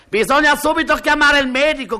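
high, loud panicked call for the doctor; a mother yelling for the doctor; mother shrieking to fetch the doctor; shouting mother. Retold or imitated. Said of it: "grida la madre".

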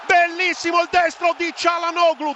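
rising shout of goal; goal call. Real, not acted. Goal call in live commentary. (urlo da gol)

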